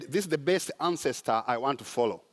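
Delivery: ordinary talk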